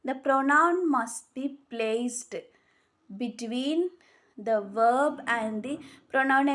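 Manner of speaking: very slow, word by word, for note-taking; slow dictation. Shown as a demonstration. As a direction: spell the rule out slowly so students can write it. Dictating the rule slowly.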